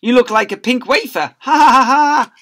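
high, loud taunting laugh; jeering joker. Acted. Joker mocking the pink costume, loud taunting laugh. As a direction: taunt and cackle.

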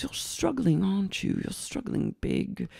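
soft, mock-concerned boss; put-on soft pity. Boss prying at struggling waitress, soft put-on pity. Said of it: "She goes".

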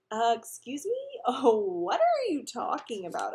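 slow, loud, pitch-swooping disbelief; incredulous. A narrator's reading. Peter denies being in love to Alex, incredulous. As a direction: act baffled, drawing out the question. Teased about love, flustered denial.

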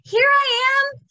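high, loud answering call. Acted. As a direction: shout back from far away. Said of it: "said Claire".

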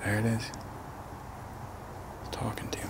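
frightened whisper. Real, not acted. Hearing the creature close by in the dark, whispering. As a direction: drop to a hush so it won't hear.

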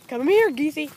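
loud come-here call. Real, not acted. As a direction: call them over, coaxing. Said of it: "calling the geese".

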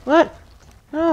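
sharp high startled what; jolted high disbelief; shocked. Real, not acted. Sudden shock at game event.

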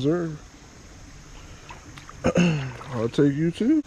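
ordinary talk

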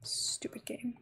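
whispered grumble. Real, not acted. Annoyed at the game, whispered under her breath.

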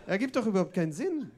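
usual speaking voice

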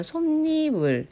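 솜니물 with a drawn-out 니; demonstrated ㄴ insertion. Shown as a demonstration. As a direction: lengthen 니 after 솜 in 솜니물. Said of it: "이렇게 나가지고".

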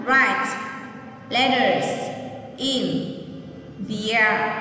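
"Write letters in the air" with a pause after each word; teacher spacing the words out for the class. Shown as a demonstration. Teacher repeating the phrase for children, word by word. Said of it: "Nghe cô đọc lại lần nữa nhé".

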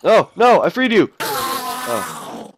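high loud alarmed cry; shrill surprise. Real, not acted. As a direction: yelp in sudden alarm. Accidental release.

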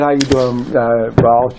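a lightly voiced, overly polite worker greeting his boss; fake-friendly greeting. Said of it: "how y'all do when you get in front of your owner".

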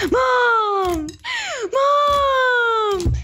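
high, long-drawn tattletale call; shouting for Mom. Retold or imitated. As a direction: act out a kid yelling for mother.

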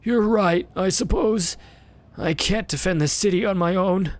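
put-on sad old beggar.

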